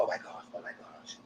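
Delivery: hushed, voiceless mock panic; imitated nervous whisper. Voicing the cast awaiting a recoupling, nervous whisper. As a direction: whisper it like a contestant on edge.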